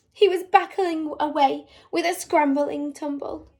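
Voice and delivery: ordinary delivery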